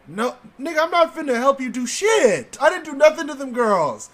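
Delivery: put-on exasperated ten-year-old yelling no; mock yell. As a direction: shout it back at him half laughing. Retold snapping at the kid.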